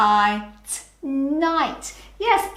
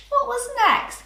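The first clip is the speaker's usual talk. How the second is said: teacher prompt; 13 semitones higher than usual. Shown as a demonstration.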